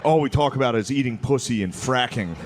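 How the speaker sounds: normal talking voice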